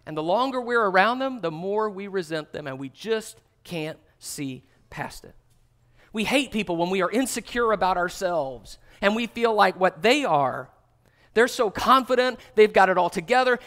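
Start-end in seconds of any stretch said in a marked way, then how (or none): none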